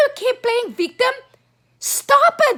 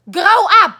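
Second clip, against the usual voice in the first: angry shout; 6 dB louder than usual. Real, not acted. Angry rant at politicians.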